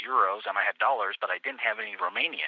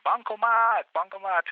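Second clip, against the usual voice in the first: police pointing it out; 9 semitones higher than usual. Retold or imitated. Policemen to the tourist, pointing at the ATM, higher voiced. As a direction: announce "Bancomat" like a helpful cop.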